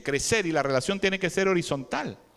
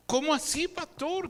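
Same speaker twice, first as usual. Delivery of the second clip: mock puzzled congregant; 7 semitones higher than usual. Congregant asks, high.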